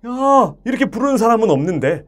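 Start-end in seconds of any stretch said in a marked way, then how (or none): 0.1-0.5 high, swelling sound kept back; badly projected singing "아"; held-in singer's call. Shown as a demonstration. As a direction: sing out without handing it over. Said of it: "노래할 때는 이런 소리".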